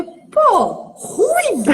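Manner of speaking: high, loud "ruivo?" in comic disbelief; mock horror at the name meaning redhead; shocked disbelief. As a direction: squeal it like you can't believe it. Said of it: "Aí eu falei".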